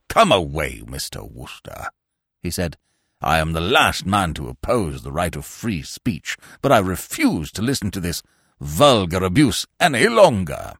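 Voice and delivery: low and stiff, wounded dignity held in check; clipped indignation of a stuffy peer; offended pompous lord. Acted.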